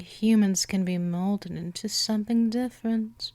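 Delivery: ordinary talk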